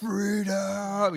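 high rallying yell of freedom; Braveheart battle cry. Retold or imitated. Preacher mimics Braveheart's cry to the congregation, rallying yell. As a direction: shout it like Braveheart charging. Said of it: "it's like Braveheart".

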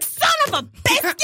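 high-pitched comic curse; mock exasperated cry. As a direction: squeal it like a fake swear. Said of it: "I'm like".

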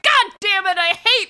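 high-pitched exasperated outburst; mock fury. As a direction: curse in hammed-up frustration. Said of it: "and he's like".

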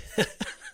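sudden high giggling burst; real high-pitched laugh; burst of laughter. Real, not acted. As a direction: break into a high laugh at the story. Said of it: "what are you laughing at, Bob?".

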